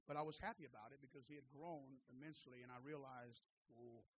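low, very soft confiding voice; hushed reflection. Real, not acted. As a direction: let the voice drop right down, humbled. Subdued personal testimony after preaching.

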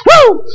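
high, loud preacher's whoop; shouted woo of praise; ecstatic whoop. Real, not acted. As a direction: let out a joyful woo.

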